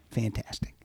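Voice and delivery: whispered, deadpan Fantastic; a hushed sarcastic aside to himself. Retold or imitated. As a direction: breathe it out, no voice, bitterly dry. Sarcastic reply on hearing news.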